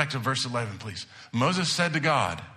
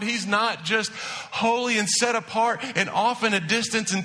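fervent preaching; 6 semitones higher than usual. Acted. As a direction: preach it, rising with each name. Preaching the names of God.

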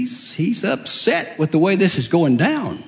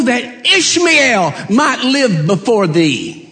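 high, slow plea; high slow pleading prayer; 7 semitones higher, slower than usual. Acted. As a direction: beg God for your son. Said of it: "That's what he prayed".

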